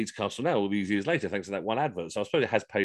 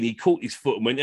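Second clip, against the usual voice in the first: normal talking voice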